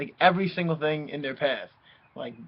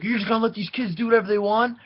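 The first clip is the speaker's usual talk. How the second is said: raised, fast indignant protest; imitating the furious owner, quicker; mock angry owner; 7 semitones higher, faster than usual. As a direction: play the outraged homeowner, half-hearted. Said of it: "So then he was mad".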